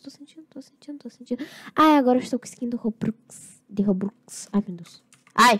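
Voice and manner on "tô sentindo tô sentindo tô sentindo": whispering. Real, not acted. Tense moment in horror game.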